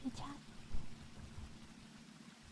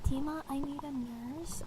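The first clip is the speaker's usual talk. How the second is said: hushed close-mic plea; whispering; 3 semitones higher than usual. Acted. Whispered request.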